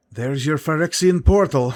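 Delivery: plain reading voice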